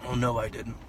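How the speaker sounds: normal talking voice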